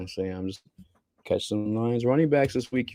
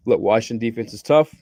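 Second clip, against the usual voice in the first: normal talking voice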